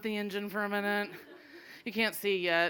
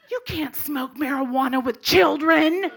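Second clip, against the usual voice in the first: loud, slow, high mock scolding; scandalized officer; 5 semitones higher, 7 dB louder, slower than usual. Officer scolds woman smoking with children, scandalized. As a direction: build to an appalled shout.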